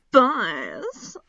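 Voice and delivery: loud, slow, wailing bee voice; hammed-up bee cry. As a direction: stretch it out like a dramatic bee. Playing a bee character.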